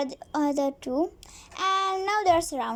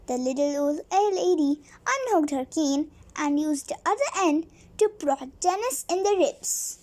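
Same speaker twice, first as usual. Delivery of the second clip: ordinary delivery, nothing marked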